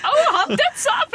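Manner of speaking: high, loud squealed reaction; mock cry. Co-host playing the date watching a dive, high mock cry. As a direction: cry it out high, laughing along.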